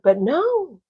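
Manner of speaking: normal talking voice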